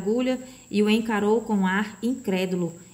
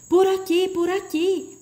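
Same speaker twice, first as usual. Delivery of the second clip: high, urgent guiding call; 11 semitones higher, 3 dB louder than usual. A narrator's reading. The voice calls them toward it, urgently. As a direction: call them over in a raised pitch. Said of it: "a voz chamou de novo".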